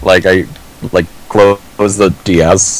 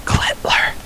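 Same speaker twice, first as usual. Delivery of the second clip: soft, furtive whisper; 8 dB quieter than usual. Real, not acted. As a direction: whisper it like a naughty joke. Sneaking in a rude joke word.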